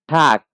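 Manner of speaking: ঠাক said loud as German Tag; German word demo. Shown as a demonstration. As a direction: model ঠাক for the class. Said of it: "বা দিন". (German উচ্চারণে ঠাক)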